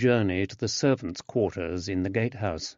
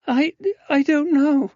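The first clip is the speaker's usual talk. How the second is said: put-on much higher, louder young woman in dismay; distressed young lady; 6 dB louder than usual. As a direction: cry out like a shocked young lady. Susanna flustered.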